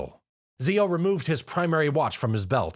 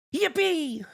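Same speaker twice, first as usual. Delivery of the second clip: much higher, bouncy cheer. Acted. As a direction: squeal it with pure glee. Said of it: "Pinkie Pie cheered".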